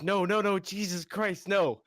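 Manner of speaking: high, loud panicked cries of no; panicked priest girl. Retold or imitated. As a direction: shriek it out in a panic. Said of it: "the priest girl's like".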